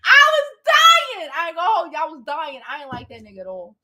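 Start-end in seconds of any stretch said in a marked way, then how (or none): 0.0-1.3 very high, loud laugh; laughing hard. Real, not acted. Cracking up telling viewers about her friend's answer. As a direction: howl with laughter.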